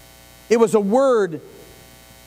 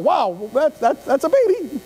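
hammed-up awkward praise; 5 semitones higher than usual. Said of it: "at least that's truthful".

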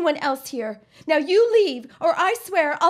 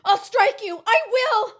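frightened threat; 7 semitones higher than usual. Acted. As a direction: warn him off in rising panic. Threatened, brandishing mallet.

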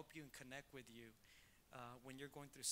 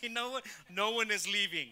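loud, raised-pitch retold decree; playful shouted insistence; emphatic protest; 7 semitones higher, 16 dB louder than usual. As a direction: put your foot down loudly in mock outrage. Mock protest to colleague, retold.

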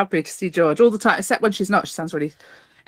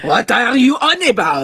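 angry mock French accent, pitched up; indignant put-on French accent, badly done; 4 semitones higher, 3 dB louder than usual. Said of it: "I can't do a very good accent".

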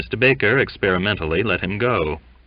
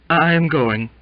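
plain reading voice

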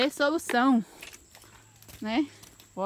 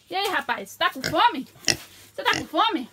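higher, loud coaxing pet voice; talking to a pet; 6 semitones higher, 4 dB louder than usual. Real, not acted. Farmer coaxing her hungry pig in a higher pet voice.

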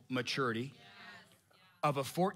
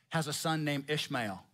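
ordinary talk, nothing marked

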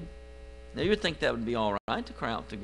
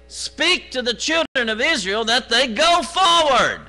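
loud, high commanding voice of God; God ordering Moses to move the people, loud and high; God speaking; 11 semitones higher, 8 dB louder than usual. Acted. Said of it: "God speaking".

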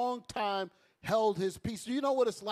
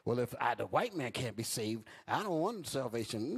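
lower, softer mock whine of a doubter; 8 semitones lower, 5 dB quieter than usual. Preacher imitating a doubter rejecting salvation, lower and softer. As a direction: mutter it like a lost fool. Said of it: "Here go a sick Negro".

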